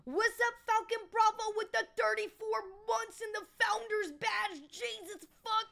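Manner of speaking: high, loud streamer hype; hyped shout-out. Real, not acted. Streamer hailing a long-time subscriber, hyped and loud. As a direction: belt out an over-the-top greeting.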